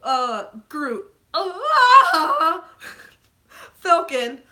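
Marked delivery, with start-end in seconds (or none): 1.3-2.6 loud, high mock wail; demonstrated wailing. Shown as a demonstration. Showing her crying level for Groot, loud wailing. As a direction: burst into an exaggerated bawl. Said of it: "my crying level. I'll show you".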